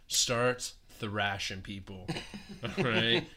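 ordinary delivery

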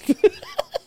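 short amused burst; high laugh. Real, not acted. Reacting to his own punchline, laughing.

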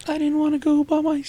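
high-pitched girl imitation; mock little girl. Co-host mimicking the girlfriend by the murder house, high voice. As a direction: squeak it like a timid child.

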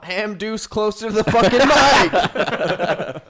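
slow mocking repeat; teasing echo. Friend slowly mocking the shout, telling him to move closer. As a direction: drag it out to tease him.

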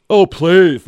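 loud, high mock yell; hammed-up shout of a party guest; retold yell. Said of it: "And he's yelling".

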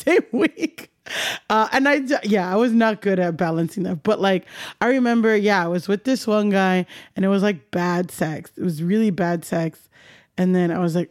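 ordinary talk

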